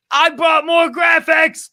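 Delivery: high, loud, drawn-out silly shout; over-the-top high shouted voice; silly put-on voice. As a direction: yell it slowly in a high goofy voice. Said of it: "I did that voice".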